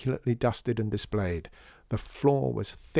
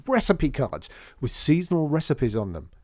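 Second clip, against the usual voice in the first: higher, animated burst of an idea; excited; 5 semitones higher, 4 dB louder than usual. A narrator's reading. John suddenly has an idea for recipe cards, excited. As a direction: pitch a brainwave with eager emphasis. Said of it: "he said excitedly".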